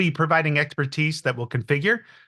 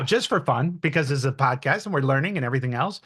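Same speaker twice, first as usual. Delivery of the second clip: ordinary delivery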